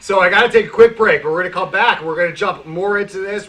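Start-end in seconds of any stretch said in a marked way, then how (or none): 0.0-1.2 said again, louder and higher; louder repeat. Real, not acted. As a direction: bump it up on the repeat. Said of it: "You can tell I've had four or five cups of coffee already".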